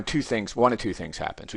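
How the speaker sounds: usual speaking voice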